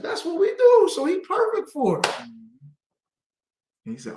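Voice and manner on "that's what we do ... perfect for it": high, bright-energy affirmation; proud excitement. Retold or imitated. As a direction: say it with pride and excitement. Retells excited answer to uncle.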